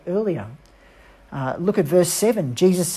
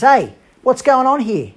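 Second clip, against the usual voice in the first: loud, higher call across the field; yelling out; 7 semitones higher, 6 dB louder than usual. Acted. Older brother calls to a servant, loud and higher. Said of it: "he yells out".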